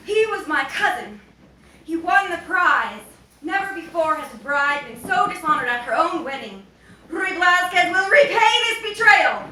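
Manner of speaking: furious bride. A narrator's reading. Lambra raging at Sancha over her cousin's death, shouting. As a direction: scream out the threat.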